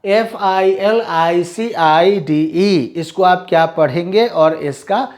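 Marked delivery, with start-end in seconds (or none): 0.1-2.9 letters spelled slowly, one by one; slow spelling out. Shown as a demonstration. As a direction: dictate each letter slowly for the class. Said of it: "मैं सिर्फ spellings पढ़ूंगा".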